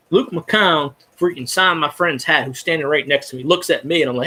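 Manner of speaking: normal talking voice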